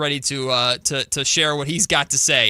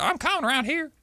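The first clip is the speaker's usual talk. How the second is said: imitated South Carolina accent; 12 semitones higher than usual. Showing how the aunt talks now, exaggerated Southern accent. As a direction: play up the aunt's Carolina twang. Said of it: "And that's how she's like".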